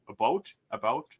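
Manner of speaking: "about" rounded toward a-BOAT; mother-in-law's about. Retold or imitated. Trying out mother-in-law's accent, a-BOAT "about". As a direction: say "about" like a-BOAT, imitating her. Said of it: "she'll say about".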